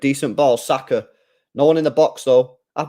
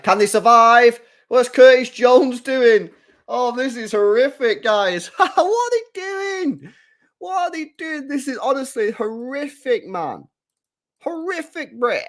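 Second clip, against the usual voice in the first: much higher and louder, stretched out in disbelief; incredulous yelling; 11 semitones higher, 5 dB louder, slower than usual. Real, not acted. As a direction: shriek at the shambolic defending. Fan reacts to chaotic defending.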